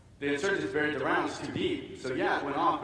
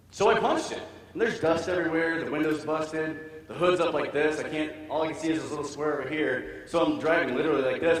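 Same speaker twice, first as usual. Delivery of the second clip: ordinary delivery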